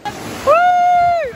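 high, loud whoop; excited whoop. Real, not acted. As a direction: whoop at the wave. Riding a wave into the sea.

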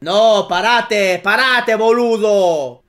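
loud, drawn-out Spanish protest. Real, not acted. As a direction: call a halt loudly, stretching the words. Calling a halt to request.

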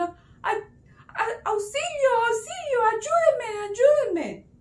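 high, loud mock distress call; cry for help. A narrator's reading. Faked Rama voice calls from afar, crying for help. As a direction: wail for rescue, storybook style. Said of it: "oyeron una voz que parecía como si fuera el señor Rama".

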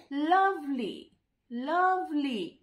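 লাভলি drawn out slowly as two syllables, লাভ-লি. Shown as a demonstration. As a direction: stretch both halves of লাভলি. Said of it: "উচ্চারণ করার সময় তুমি দেখতে পাচ্ছ". (লাভলি দুই সিলেবলে টেনে উচ্চারণ)

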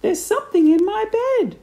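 very high, loud shriek; screamed panic. A narrator's reading. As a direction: shriek like a terrified wife. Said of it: "screamed Missus Twit, bouncing about".

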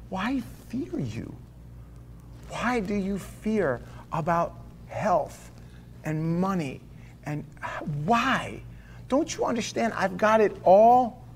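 a hushed, slow, lightly voiced God speaking. Preacher voicing God to his congregation, hushed and slow. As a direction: let God murmur the promise softly. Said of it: "He's saying".

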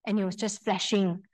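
usual speaking voice

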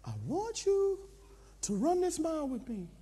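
measured instruction in a high soft voice; trainer's voice. Acted. Trainer asking the pastor to run a mile, high and soft. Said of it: "He says".

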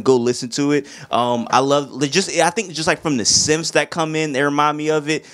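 normal talking voice

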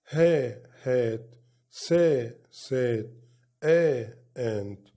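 each syllable then word, like فا then فات; phonics drill. Shown as a demonstration. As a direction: sound out "آت" slowly, part then whole. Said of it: "يبقى تتنطق إزاي؟". (تهجئة صوتية مقطع مقطع)

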